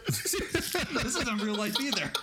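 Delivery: slow, high, laughing retort. Real, not acted. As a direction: laugh it out slowly.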